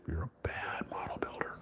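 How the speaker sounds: hushed scolding whisper. Pretend. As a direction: breathe it as a verdict. Saint Peter's whispered verdict.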